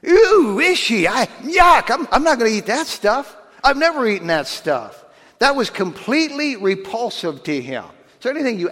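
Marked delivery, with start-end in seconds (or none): 0.0-5.0 high, loud squealing "Ew" and "yuck"; horrified disgust. Retold or imitated. Peter recoiling from unclean animals, hammed-up disgust. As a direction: recoil from the food in mock horror. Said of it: "Peter's horrified".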